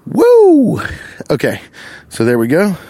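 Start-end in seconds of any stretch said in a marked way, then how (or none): 0.1-0.8 high, loud "woo"; one long high-pitched holler; high whoop. Real, not acted. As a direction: whoop it up for the camera. Whooping for the Instagram post.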